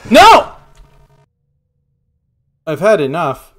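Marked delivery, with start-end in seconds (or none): 0.1-0.5 high panicked shriek; being chased. Real, not acted. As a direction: scream as it grabs you. Monster catching player.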